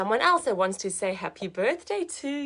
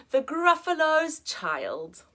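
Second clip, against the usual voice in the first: ordinary delivery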